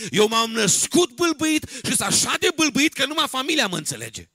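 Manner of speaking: loud, high-pitched animated sermon storytelling; full-force preaching. Acted. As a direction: shout the tale from the pulpit, acting out the speakers.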